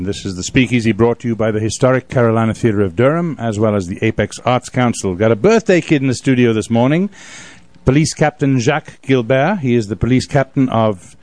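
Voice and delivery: ordinary talk, nothing marked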